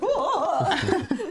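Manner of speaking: high, laughing whoa; laughing hammed-up whoa; mock startled whoa. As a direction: jump back in fake alarm. Startled by boyfriend freaking out.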